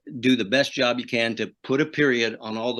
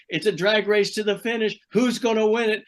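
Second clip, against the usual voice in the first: raised-pitch race announcer excitement; broadcast call; 9 semitones higher than usual. Retold or imitated. Race announcer recreating his call of the finish, excited broadcast voice. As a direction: call the close finish live on air. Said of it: "hear me on the broadcast say".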